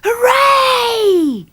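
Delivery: high, loud, long-held cheer; joyful shout. A narrator's reading. Children hearing of a beach trip, shouting with joy. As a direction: cheer at the top of your voice. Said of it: "we both shouted".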